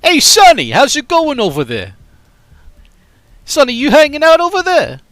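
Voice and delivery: loud, high New York street voice; brash New Yorker calling Sonny; New York accent. Shown as a demonstration. Streamer does New York accent for chat. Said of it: "talk like I'm from New York".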